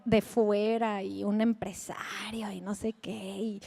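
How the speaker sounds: whispered aside, low and slow. Real, not acted. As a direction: drop to a gossipy whisper. Aside gossip lowered. (susurro confidencial)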